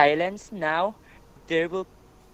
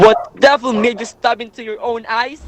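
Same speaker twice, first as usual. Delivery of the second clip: horrified shout; 3 semitones higher, 10 dB louder than usual. Acted. Horrified at blinded king.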